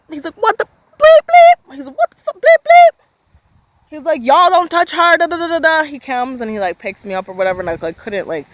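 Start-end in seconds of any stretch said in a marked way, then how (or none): 0.3-1.7 very high, loud beeped-out swearing; imitated censor bleep. Storyteller censoring her angry brother's swearing, piercing mock bleeps. 2.0-3.0 very high, loud beeped-out swearing; imitated censor bleep. Storyteller censoring her angry brother's swearing, piercing mock bleeps. 4.2-5.8 high, loud mock rant; imitated angry warning. Storyteller as brother warning players off his sister, mock angry yelling.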